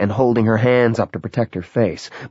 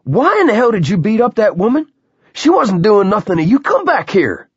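much higher, louder angry shout from behind; 12 semitones higher, 5 dB louder than usual. A narrator's reading. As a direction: yell after them across the lot. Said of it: "someone shouted from behind them".

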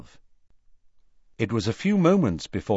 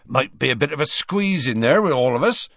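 higher-pitched gruff-hearted friend, lightly voiced; lightly voiced Hagrid; 6 semitones higher, 4 dB louder than usual. A narrator's reading. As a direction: voice a friendly helper, a little raised in pitch. Hagrid's line in narration.

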